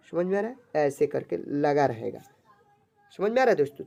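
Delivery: ordinary delivery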